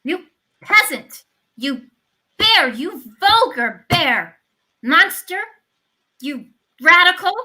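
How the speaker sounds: furious insults. Acted. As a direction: spit each insult at him. Widow hurling insults.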